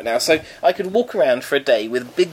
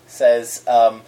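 ordinary talk

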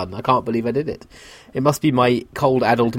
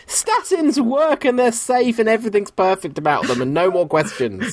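high sing-song put-on cheer; mock credulous; 9 semitones higher than usual. Co-host parroting that statins are perfect, high mock-credulous voice. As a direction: recite it like an unquestioning believer, pitched high. Mock-gullible reciting of approved line.

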